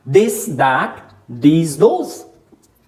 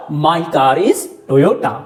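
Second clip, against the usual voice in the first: slow example; 2 semitones lower, much slower than usual. Shown as a demonstration. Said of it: "मैंने कहा".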